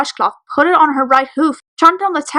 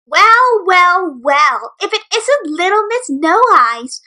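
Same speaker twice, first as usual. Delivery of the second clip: higher, slow mocking taunt; taunting bully; 6 semitones higher, 5 dB louder, slower than usual. Bully taunting the blind filly, slow sneering sing-song. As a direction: drag out the taunt to sneer at her. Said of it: "the bullies' dancer yelled".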